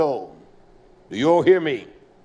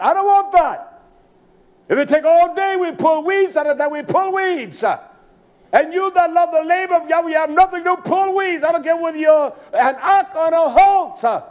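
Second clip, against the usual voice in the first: loud, high preaching climax; preaching at full force; 7 semitones higher, 4 dB louder than usual. Real, not acted. Preacher exhorting the congregation, loud and high. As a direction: drive it home to the congregation.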